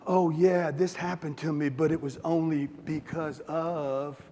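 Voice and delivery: lightly voiced false modesty, slow at the end; mock humble brag. Mocking a humble bragger's excuse, lightly voiced and slow. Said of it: "this humble brag thing".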